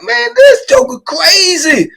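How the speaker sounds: high, slow, cracking cry of disbelief; shocked disbelief. Retold or imitated. Retelling the shooting to a friend, high shocked cry. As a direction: gasp it out in shock. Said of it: "I'm like".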